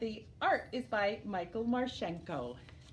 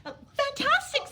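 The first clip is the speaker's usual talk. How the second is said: much higher, louder mock delight; fantastic; 14 semitones higher, 7 dB louder than usual. A narrator's reading. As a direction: gush with fake amazement. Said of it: "said Elizabeth".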